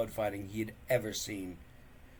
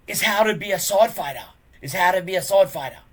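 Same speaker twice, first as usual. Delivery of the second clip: quick loud sneer, high; sneering, fast; 8 semitones higher, 12 dB louder, faster than usual. Acted. Bully boasting to his cousin, fast loud sneer. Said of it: "sneered Snotlout".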